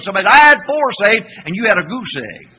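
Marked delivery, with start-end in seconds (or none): 0.3-1.3 smug bragging church member; a smug boast to the preacher; 1 mock boast. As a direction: crow about it proudly.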